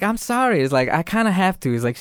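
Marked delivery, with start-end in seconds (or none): 0.0-0.6 high-pitched mock apology; apologetic killer doll. Chucky apologising to little girl, sheepish. 0.9-1.7 high-pitched doll making excuses; excusing killer doll. Retold or imitated. Chucky excusing himself to little girl, apologetic.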